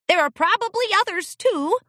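put-on high, quick boyish voice; young colt voice. A narrator's reading. Colt adds unsure.

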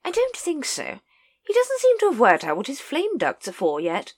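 very high, hearty lady; lady correcting Nobby, very high and hearty. A narrator's reading. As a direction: chirp it like a jolly aristocrat. Lady Ramkin.

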